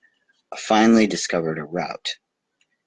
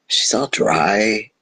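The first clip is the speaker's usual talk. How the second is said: slow disdainful remark; 3 semitones higher, 5 dB louder, much slower than usual. Acted. Prince judging the strange planet.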